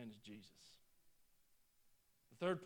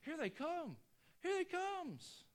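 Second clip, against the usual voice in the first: high, slow, jubilant call; joyful welcome; 11 semitones higher, slower than usual. Pretend. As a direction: cheer them home from the far shore. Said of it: "They're celebrating".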